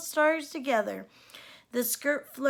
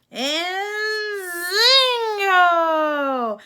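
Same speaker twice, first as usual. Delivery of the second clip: long, high, much louder zingo; magic cry; 7 semitones higher, 9 dB louder, much slower than usual. A narrator's reading. Fairy godmother crying out as she works the magic. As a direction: ring it out like a spell.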